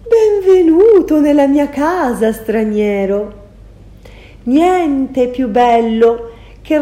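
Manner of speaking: high, loud, honeyed greeting; sweet sorceress welcome. Acted. Circe welcoming the stranger into her house, sugary. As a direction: lure the guest in with a sugary welcome.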